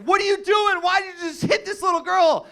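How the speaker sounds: high, loud mock outrage; imitated shocked, angry yelling; outraged bystander. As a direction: act out an appalled man confronting someone. Bystander confronts him after the punch.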